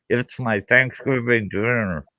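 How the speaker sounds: lightly mimicked jaw-wired mumble; talking through a wired jaw. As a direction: speak as if your teeth won't open. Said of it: "he goes".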